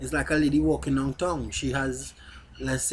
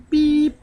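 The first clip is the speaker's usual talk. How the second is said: high, loud censor beep sound; mouth bleep; 13 semitones higher, 10 dB louder than usual. Pretend. Self-censoring a rude word.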